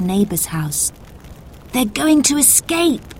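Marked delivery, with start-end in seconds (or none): 1.7-3.1 shouting. A narrator's reading. Boy watching people climb along the roof, calling out. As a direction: call it out to the others, pitched up. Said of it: "Toby shouted".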